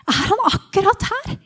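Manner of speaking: acted hopeful astonishment; excited disbelief. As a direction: burst out, hardly believing it. Blind man learns Jesus is near.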